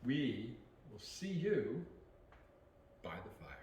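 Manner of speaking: slow, then whispered; slow sign-off sinking to a whisper; fading to whisper. Pretend. Outro sign-off, fades to whisper.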